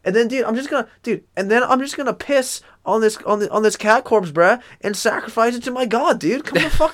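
high, lighthearted stoner bro; cheery high bro voice inviting a guest in; laid-back bro voice. Retold or imitated. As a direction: chirp it like a carefree dude bro. Continues bro killer impression.

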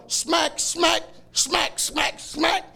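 high, clipped slap sounds; mock slaps. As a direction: smack out each hit. Retelling slapping the Buddha.

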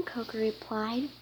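ordinary delivery, nothing marked

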